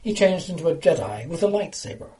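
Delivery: ordinary delivery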